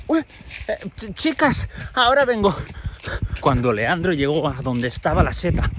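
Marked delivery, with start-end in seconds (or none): 0.1-2.5 put-on high, stammering girl's excuse; shy Ero excusing herself. Ero excusing herself to her classmates, high and shy. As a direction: fumble the words and sneak off.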